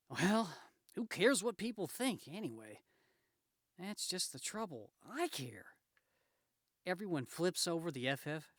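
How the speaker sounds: aggrieved Spider-Man. Acted. Spider-Man brooding on what people think, aggrieved. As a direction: complain to yourself with comic-book drama.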